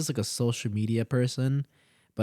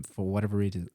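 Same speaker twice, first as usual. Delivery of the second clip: usual speaking voice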